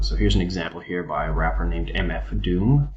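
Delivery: normal talking voice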